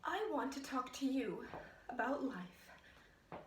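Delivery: hushed, dejected murmur; weary lament. Acted. Troubled woman confiding that her life is a mess, weary and hushed. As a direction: confide it softly, with long pauses.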